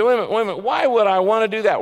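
high, loud incredulous protest; objecting listener. Pretend. A doubting listener protesting to the preacher, loud and high. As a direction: push back like a doubting listener.

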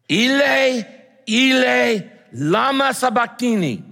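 high, loud anguished cry. Acted. Preacher voicing Jesus crying out from the cross, loud and high. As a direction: cry it out in agony. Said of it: "Jesus cried out in a loud voice".

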